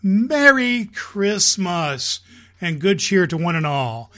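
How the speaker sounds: high, loud, slow and drawn-out merry wishes; festive Santa greeting. Acted. Santa calls out his Christmas greeting to the children, high and loud. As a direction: announce it to every child at once.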